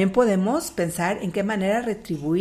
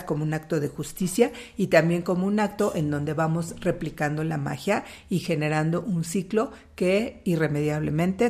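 ordinary talk

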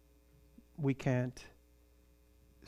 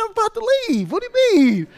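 very high, loud comic wail; exasperated protest; 10 dB louder than usual. Retold or imitated. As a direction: play up the dismay for laughs. Acted protest when asked to stay.

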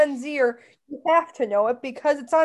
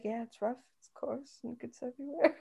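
softer, lightly voiced whiny complaint; quiet quoted movie line; 13 dB quieter than usual. Quoting the movie to Cody, softly whining. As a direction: mumble it like the famous sand line. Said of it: "I'll be like".

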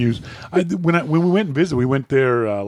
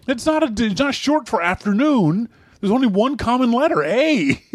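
high-pitched, indignant reasoning; exasperated protest; 8 semitones higher than usual. Real, not acted. Arguing that Arvo is not short for afternoon, exasperated protest. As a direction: press the point, pitched up and incredulous. Still disputing the abbreviation.

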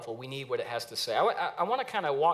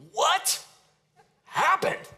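mock shock; 5 dB louder, much slower than usual. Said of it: "And it's like".

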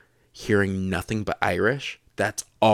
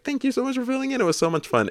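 sweet polite crew; faster than usual. Retold or imitated. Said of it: "They were like".

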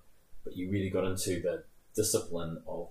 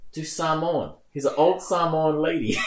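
high, raised-voice mock request; imitated audience call-out; 8 semitones higher, 7 dB louder, slower than usual. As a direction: pipe up like someone calling from the crowd. Quoting audience member shouting a request.

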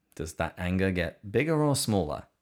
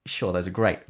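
ordinary talk, nothing marked

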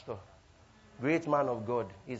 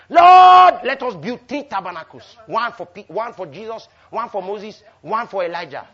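loud outburst on the first word, then high and eager; big-mouthed Peter; 6 semitones higher, 17 dB louder than usual. Retold or imitated. Preacher mimicking Peter offering tabernacles, big-mouthed blurting. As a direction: blurt it out like a loudmouth disciple. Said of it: "Peter with his big mouth said".

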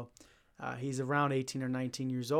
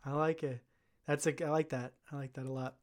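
normal talking voice

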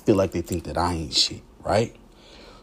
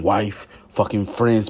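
ordinary delivery

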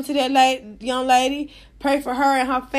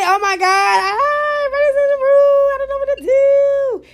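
much higher and louder, fast, near-crying; overwhelmed by the crowd; giddy squeal; excited overwhelm; 13 semitones higher, 7 dB louder than usual. Real, not acted. Overwhelmed by everyone joining.

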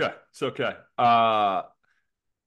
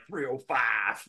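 slow, drawn-out rapper tag line; 3 semitones higher, 6 dB quieter, slower than usual. Acted. Rapper in the video's foreground, slow signature call. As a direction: stretch it out like a hype call. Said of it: "[name] just in the foreground".

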